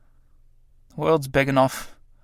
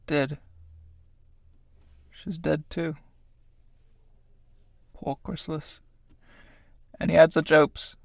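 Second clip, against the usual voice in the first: much softer, to himself; hushed grief; 7 dB quieter than usual. Acted. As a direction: murmur over the dead body. Tramp mourning the dead moths.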